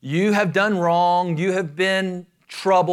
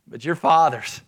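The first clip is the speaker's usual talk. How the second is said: usual speaking voice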